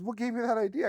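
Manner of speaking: imitating his wife's surprised question; retelling wife's baffled reply in a high voice; puzzled wife. Wife answers husband, puzzled.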